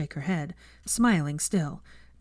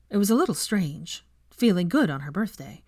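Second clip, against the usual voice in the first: ordinary delivery, nothing marked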